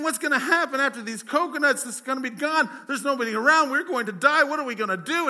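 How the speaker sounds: high, loud, frantic panic; a stranded man losing it; panicked castaway. Acted. Stranded man panicking about coconuts, frantic and loud. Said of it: "he is freaking out".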